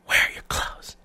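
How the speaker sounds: soft whispered question; imitating her whisper. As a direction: whisper it puzzled. Girlfriend whispering question again.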